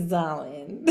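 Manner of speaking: low, soft, drawn-out posh darling; rich auntie. Pretend. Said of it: "The one that talks like this".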